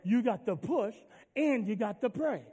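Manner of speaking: ordinary talk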